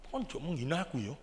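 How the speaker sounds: low, soft offended retort; imitating offended woman. Unmarried woman answering a question, a touch of offended sulk. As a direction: take the question as an insult, mildly.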